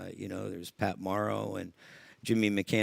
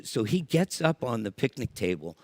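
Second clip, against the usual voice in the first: normal talking voice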